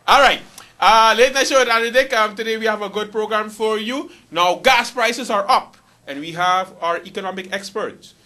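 loud, high late-night intro; show host announcing. Acted. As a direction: announce the program at full voice.

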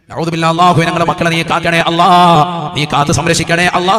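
loud, level-pitched chanted prayer; intoned supplication. Real, not acted. Preacher begs Allah to protect the children, loud intoned prayer. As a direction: call on Allah, drawing out the final word.

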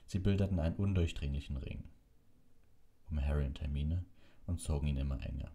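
plain reading voice

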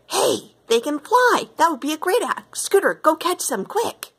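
high, eager outburst; a sudden bright idea; excited. A narrator's reading.

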